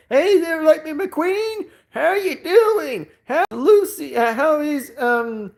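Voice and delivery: high-pitched, drawn-out Southern character voice; hammed-up impression of a folksy Southern greeting; Mater Southern accent. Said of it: "doing the Sovereign accent by Mater".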